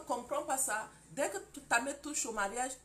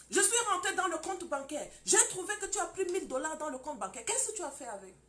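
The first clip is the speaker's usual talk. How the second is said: higher and louder, demanding answers; accusing husband; 7 semitones higher, 5 dB louder than usual. Acted. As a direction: confront her about the bank account. Husband confronts wife over money. (mari qui accuse sa femme, plus aigu et plus fort)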